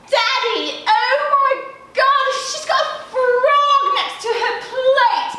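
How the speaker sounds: high, posh English outrage; fake English accent. A narrator's reading. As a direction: shriek to Daddy in mock English accent. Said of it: "speaking in a fake English accent".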